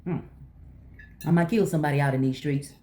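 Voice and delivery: usual speaking voice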